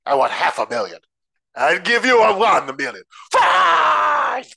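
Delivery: builds from plain to a high shout; mock bad-actor outburst, climbing to a yell; over-the-top bad acting. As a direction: ham it up, exploding on the last word. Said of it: "It's so over the top".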